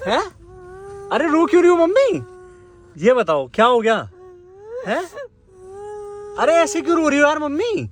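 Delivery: high-pitched, exasperated coaxing; pleading concern. Real, not acted. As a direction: plead with a crying parent, pitch jumping up. Son upset at mother crying.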